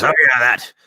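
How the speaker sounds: loud, high swaggering pirate tale; boastful old pirate. Acted. Old pirate boasting to the young sailor about his hook. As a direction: boom out the battle story. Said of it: "And he goes".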